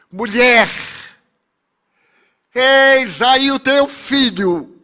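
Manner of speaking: high, loud, slowly drawn-out declaration; solemn proclamation. Acted. Dying son entrusting his mother to a disciple, loud solemn proclamation. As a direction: proclaim it loudly, stretching each word.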